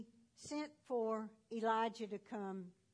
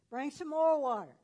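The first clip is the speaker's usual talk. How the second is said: calling out; 5 semitones higher, 10 dB louder than usual. Retold or imitated. Elijah ordering more water.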